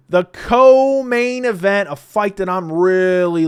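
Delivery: slow, booming, hammed-up announcement; over-the-top ring announcer, easing off at the end; mock announcer hype. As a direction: belt it high and loud, stretching each word.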